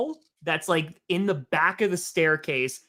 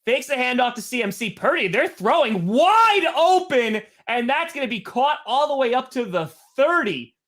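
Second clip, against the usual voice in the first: live play-by-play; 4 semitones higher than usual. Real, not acted. Streamer calling a big catch for viewers, excited play-by-play. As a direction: build it high, then ease off.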